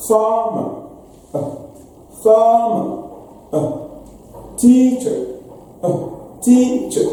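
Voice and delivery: teacher-style drill of weak endings; weak final er. Shown as a demonstration. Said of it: "words that end with E R without stress".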